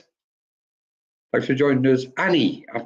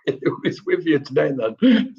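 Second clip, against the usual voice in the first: laughing; 4 semitones higher than usual. Real, not acted. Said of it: "I shouldn't be laughing".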